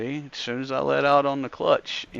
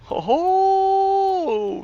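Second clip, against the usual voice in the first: ringmaster ho ho; 6 dB louder than usual. Pretend. Ringmaster style announcement opener.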